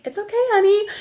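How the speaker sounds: high-pitched imitation of a placating mom; smoothing it over. Mother to her boy about the bald shopper, smoothing it over. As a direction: hush a child in a high voice. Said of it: "trying to smooth it over".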